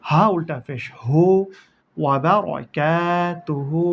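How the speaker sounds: ordinary talk, nothing marked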